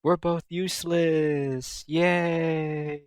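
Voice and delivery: soft, slow, deadpan cheer; deadpan yay. Retold or imitated. Uni and Neptune agreeing they're useless, soft deadpan cheer. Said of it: "Uni and Neptune have this moment".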